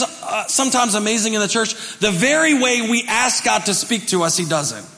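ordinary talk

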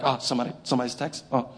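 fast, soft, sheepish excuse; hurried hushed cover-up of a guilty texter; quick muttered excuses, caught out; sheepish mock excuse. Congregation member caught texting.

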